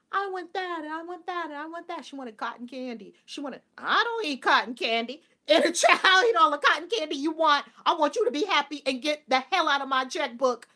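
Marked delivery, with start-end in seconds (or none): 0.1-2.0 put-on higher, insistent little-kid voice; eager demanding child. Inner child begging at Coney Island, eager and demanding. 3.8-10.7 loud, high, hammed-up generosity; exuberant indulgence. Woman spoiling her inner child, loud and exuberant.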